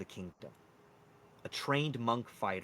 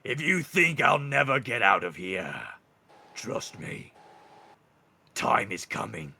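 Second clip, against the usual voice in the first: menacing minotaur; 12 dB louder than usual. Acted. Caged minotaur warning his guard of escape, menacing. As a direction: roar it, then ease down into a dark promise.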